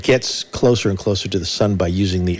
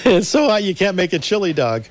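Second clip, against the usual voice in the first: brash vaudeville comic; 8 semitones higher than usual. Acted. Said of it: "Rodney Dangerfield".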